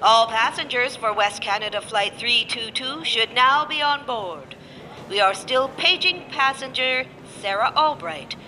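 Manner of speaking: calm, even boarding call over the PA; airport announcement. Acted. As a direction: read it like a gate agent on the tannoy. Gate agent PA announcement.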